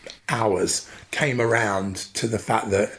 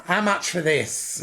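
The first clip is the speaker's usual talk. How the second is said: imitating mum; 7 semitones higher, slower than usual. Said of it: "find glittery dresses and say".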